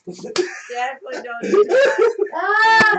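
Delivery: loud, high laughing shout; shouting through laughter. Real, not acted. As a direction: crack up and yell it. Laughing at naked stroll joke.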